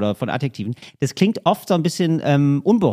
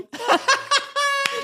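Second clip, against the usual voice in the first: very high helpless laugh; high-pitched giggling fit. Real, not acted. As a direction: crack up in a high squeal of laughter. Laughing at the magazine joke.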